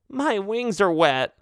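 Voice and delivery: whiny bat voice. Acted.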